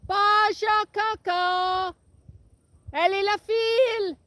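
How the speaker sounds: high, loud drawn-out French call; high cow call. Real, not acted. Farmer calling her cows in French across the wind. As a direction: sing it out to the herd. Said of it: "Let's try it again".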